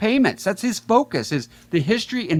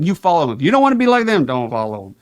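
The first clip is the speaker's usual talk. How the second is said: ordinary talk, nothing marked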